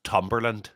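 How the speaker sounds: Tumberland with a hard T in place of th; Irish-style hard T on Tumberland; Irish pronunciation. Shown as a demonstration. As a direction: say Tumberland as the Irish would, starting on T. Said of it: "in Irish, it would be pronounced".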